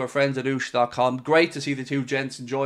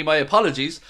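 ordinary talk, nothing marked

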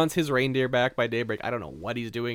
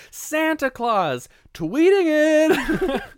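much higher, louder, slow drawn-out cry; parents exasperated; 10 dB louder, much slower than usual. Retold or imitated. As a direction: wail it out in disbelief. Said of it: "they're like".

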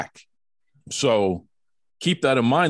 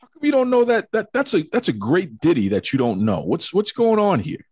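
mock indignation; 7 semitones higher than usual. Real, not acted. Host mock-indignant at guest.

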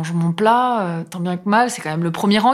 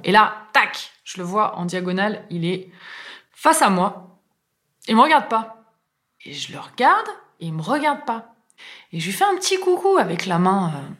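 normal talking voice

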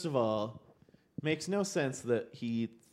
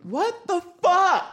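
high, loud, drawn-out disbelief; shocked outburst; 7 dB louder, slower than usual. Real, not acted. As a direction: shout it in stunned outrage. Outraged at the missing comments.

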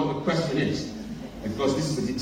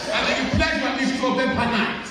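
put-on African accent; 8 semitones higher than usual. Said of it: "repeat the question in an African accent".

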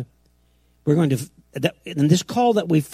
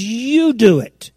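YOU stretched high and loud; slow, emphatic "you", high then falling; stress on YOU; 6 semitones higher, 5 dB louder, much slower than usual. Shown as a demonstration. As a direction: punch and lengthen "you". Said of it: "See how the emphasis on the words?".

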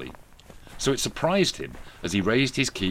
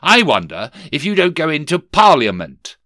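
higher, louder boss voice of a sneering Scrooge; stern miser boss; 7 semitones higher, 9 dB louder than usual. Acted. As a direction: bark it down at the clerk. Scrooge mocks nephew.

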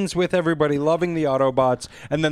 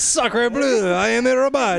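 high, hammed-up French robot; fake French accent; 7 semitones higher, slower than usual. Transformer answering someone about his accent, in fake French. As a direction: put on a silly, raised French accent. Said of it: "you got a fake French accent".

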